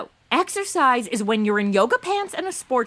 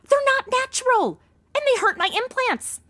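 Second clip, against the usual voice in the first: much higher, whining protest; whiny complaint; 12 semitones higher than usual. Acted. Woman griping about forties bras, high whine.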